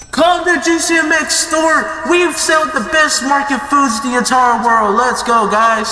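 high-pitched hawker's call; shouted store advert. Pretend. Store owner shouting out to everybody, high sales call. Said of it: "shouted out everybody saying".

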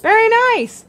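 high, loud praise; delighted praise. Real, not acted. Teacher praising the student's singing, high and delighted. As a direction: praise her warmly.

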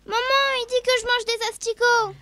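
high whiny tattletale voice; tattling child. Acted. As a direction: complain to mom in a high whine. Sister tattling to mother.

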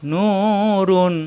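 drawn-out Quranic nurun, slow; slow drawn-out recitation. Shown as a demonstration. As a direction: stretch Nurun with a long vowel. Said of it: "একালিপ টান".

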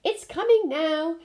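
a lightly hurried busy cow answering. A narrator's reading. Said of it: "said a busy cow".

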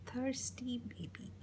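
breathy whispered title. Shown as a demonstration. Reader whispering the title to a child. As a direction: whisper it like a secret.

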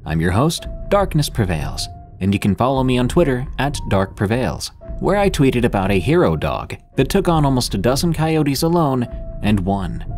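plain reading voice

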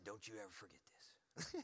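low, soft, grave warning; quiet intensity of an aggressive father; imitating warrior dad. Warrior dad charges his son to remember, imitated grave warning. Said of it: "my dad's aggressive, man. He's a warrior".

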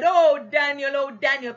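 preacher imitating a shouted call; retold shout. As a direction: call out as the worried king, half-shouted. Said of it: "and he shouted, he said".

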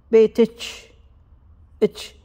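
بيت then اتش as a separate ch; split-up بيت اتش demo; t and sh apart. Shown as a demonstration. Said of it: "فظهر صوت الشا".

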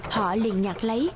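ordinary delivery, nothing marked

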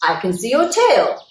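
loud accusing declaration; declared. Acted. Bear unmasks Mouse, loud and accusing. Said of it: "declared Bear".

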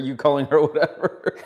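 high, loud words through laughter; real laugh breaking into the words. Real, not acted. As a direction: crack up while teasing. Cracking up over the name mix-up.

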